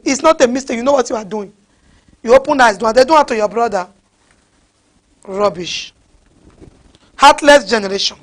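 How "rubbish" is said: low, soft, disgusted verdict; contemptuous dismissal. Real, not acted. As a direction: drop the voice in real contempt. Contemptuous dismissal of those rebuked.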